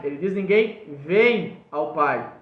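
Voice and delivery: "vem" stressed, slowed down. Shown as a demonstration. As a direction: hit "vem" to set it against "vai". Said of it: "Ele não diz ninguém vai".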